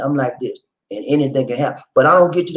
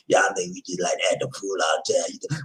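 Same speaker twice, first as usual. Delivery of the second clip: ordinary delivery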